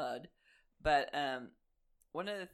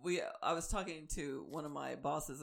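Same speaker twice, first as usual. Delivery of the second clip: usual speaking voice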